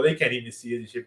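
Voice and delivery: ordinary delivery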